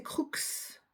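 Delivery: crux with the final X sounded as "ks"; Latin pronunciation. Shown as a demonstration. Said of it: "En latin".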